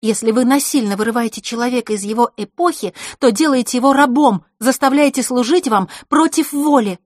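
loud, high indignant accusation; heated accusation. Acted. Scene: Vasilisa accusing Zakhara of slavery, loud and high. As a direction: scold the hostess with rising heat.

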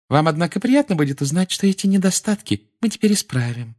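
high, saccharine lecturing; sugary Umbridge voice. A narrator's reading. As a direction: lecture with condescending sweetness.

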